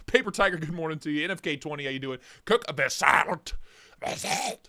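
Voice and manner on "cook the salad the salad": silly name voice. Pretend. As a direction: play up the name in a silly, distorted voice. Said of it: "that's how I say his last name".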